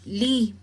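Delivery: "li" set apart and emphasized; stressed syllable. Shown as a demonstration. Teacher pointing out the penultimate syllable, "li" with emphasis. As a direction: hit "li" as the strong syllable. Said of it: "Es la que se suena más fuerte".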